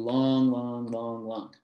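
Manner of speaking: each "long" held for two even beats; drawn-out long beats. Shown as a demonstration. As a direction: stretch every "long" evenly, like counting out meter. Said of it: "actually pronounced longer than the short syllables".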